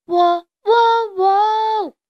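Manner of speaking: high, loud sustained crowing; forceful rooster crow. A narrator's reading. Little rooster facing down the charging horse, forceful crowing. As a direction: crow with all your might. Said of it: "冲着大马使劲地叫起来".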